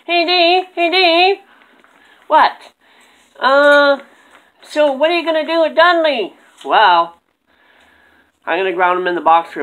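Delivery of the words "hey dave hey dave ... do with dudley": high squeaky puppet voice; squeaky ventriloquist dummy. Acted. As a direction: squeak out a call to Dave. Dummy calls Dave.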